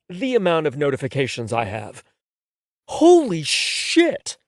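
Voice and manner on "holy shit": shocked. A narrator's reading. Shocked at notification count.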